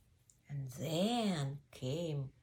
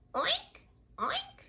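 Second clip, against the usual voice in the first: very high squeaky pig oinks; two tiny squealed oinks; piggy oinks. Acted. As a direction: squeak it like a piglet. Pig makes its sound.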